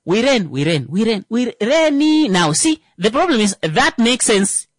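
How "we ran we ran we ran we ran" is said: "we ran" repeated to show distance; drawn-out repetition. Shown as a demonstration. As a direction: repeat we ran, slowing and rising on the last. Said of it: "has time for that".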